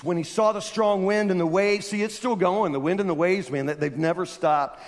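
fiery sermon delivery; preaching. Acted. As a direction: build the story with force. Preaching at full force.

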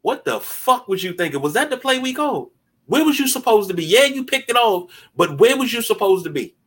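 mild mock coach rant; imitating an angry coach. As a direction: play the coach demanding answers. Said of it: "Coach asked me straight up".

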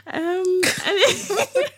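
ordinary delivery

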